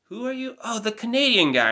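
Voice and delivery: high, mock-puzzled teacher voice; hammed-up teacher guessing the wrong nationality; imitating the teacher. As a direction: play the teacher squinting to place a former student. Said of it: "She was just like".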